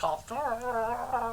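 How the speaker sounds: soft little hamster voice while munching; hamster eating voice. Pretend. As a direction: squeak it out like a nibbling hamster. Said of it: "That was my hamster eating voice".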